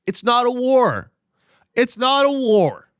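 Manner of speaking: high, loud, sarcastic official line; mock official denial.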